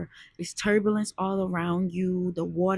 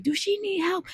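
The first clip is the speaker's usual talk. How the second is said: boaters asking; 12 semitones higher than usual. Retold or imitated. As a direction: voice the concerned strangers on the boat. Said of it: "They like".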